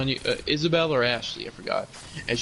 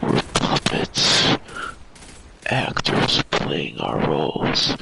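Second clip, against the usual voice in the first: mock ominous whisper; 10 semitones lower, much slower than usual. Dramatic whisper then deep voice.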